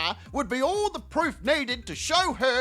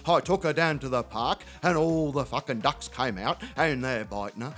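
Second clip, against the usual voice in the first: neighbour Terry; 10 semitones lower than usual. Retold or imitated. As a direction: play the mate next door, voice sunk way down. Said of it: "He goes".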